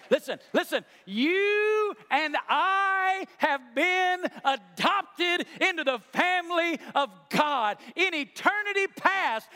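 high, loud pulpit delivery; full-force preaching. Acted. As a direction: preach it with rising fervor. Preacher drives home the point.

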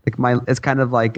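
normal talking voice

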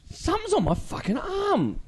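high, slow mock-panicked cry. Ghost hunter feeling something on his arm, high mock panic. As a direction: play up the fright.

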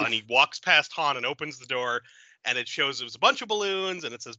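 ordinary delivery

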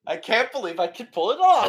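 thrilled, fast and high; can't believe it worked; excited. Real, not acted. Player thrilled at success.

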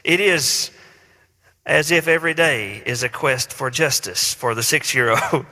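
ordinary delivery

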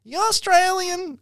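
put-on high, slow American country accent; drawn-out, high-pitched American country question; country American woman. American woman asking a visitor's nationality, high slow country accent. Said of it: "very American, like, country-ish woman".

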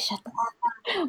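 imitated sleepy grumble, very soft; sleep-talking. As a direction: mumble it slowly as if half asleep. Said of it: "in her sleep, she was like".

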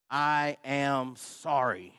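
very slow, word-by-word apology; deliberate apology. Retold or imitated. Husband making himself apologize to wife, deliberate. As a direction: spell out each word as a big effort.

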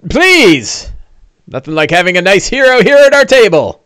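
high-pitched, eager dwarf host; welcoming dwarf. Acted. Dwarf invites the hero to the table, welcoming high voice. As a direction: welcome them in a high character voice.

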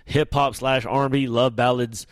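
usual speaking voice

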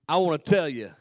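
loud, steady prayer from the pulpit; preaching prayer. Acted. Preacher praying over the congregation at full voice. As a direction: lift it up as a fervent prayer.

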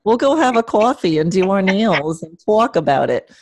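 Long Island accent, lightly put on; a quick Long Island accent impression. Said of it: "I can do the Long Island accent".

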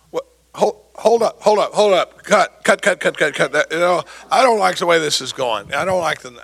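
loudly calling a halt; mock directing. Meddling actor interrupts the play, mock bossy call.

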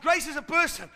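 insistent, forceful pulpit voice; emphatic preaching repeat. Real, not acted.

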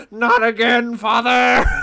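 mock anguished scream of a defeated son; anguished cry. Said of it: "the firstborn goes".

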